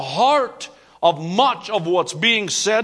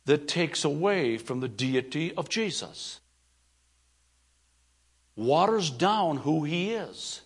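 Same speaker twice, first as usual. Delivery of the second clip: low, much softer, grave; quiet earnest warning; 3 semitones lower, 4 dB quieter than usual. Acted. Preacher warning his church about a movement, low and grave. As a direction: drop the voice to warn them gently.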